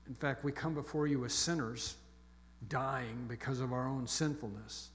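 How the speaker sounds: soft, reverent prayer voice; hushed pastoral prayer; closing prayer. Real, not acted. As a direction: pray quietly over the congregation.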